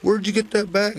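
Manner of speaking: she's caught off guard by her auntie's bag; surprised question. Acted. Girl startled to see the bag.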